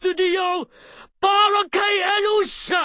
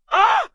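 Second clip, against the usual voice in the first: sharp cry; 13 semitones higher, 3 dB louder than usual. Acted. Sudden outburst in scolding.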